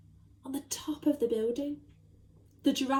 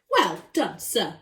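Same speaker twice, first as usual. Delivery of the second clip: louder cheer of praise; shouted; 4 semitones lower, 4 dB louder, slower than usual. Acted. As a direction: call out praise. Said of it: "shouted the Duke".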